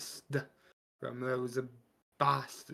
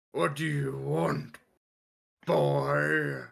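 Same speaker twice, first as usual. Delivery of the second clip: a touch of a gruff orc; lightly voiced orc demanding; 4 dB louder, much slower than usual. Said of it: "but the orc says".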